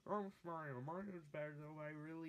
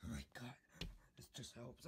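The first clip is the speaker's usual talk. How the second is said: whisper; 4 semitones lower, 7 dB quieter, faster than usual. Acted. Whispered after outburst.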